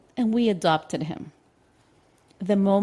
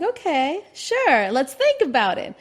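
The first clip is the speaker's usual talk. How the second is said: humouring; 8 semitones higher, 4 dB louder than usual. Retold or imitated. Reluctantly humouring partner.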